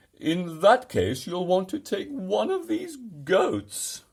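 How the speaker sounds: high, loud, slow creature voice; monster voice. A narrator's reading. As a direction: drag it out as a huffy beast. Monster Hetty advising.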